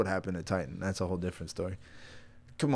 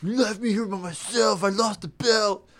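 high, pleading jilted buddy voice; whiny best friend; 9 semitones higher than usual. Acted. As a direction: play the abandoned wrestling partner. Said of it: "a B F F quarrel".

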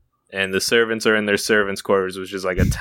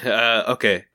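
ordinary delivery

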